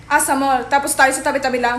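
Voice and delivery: ordinary talk, nothing marked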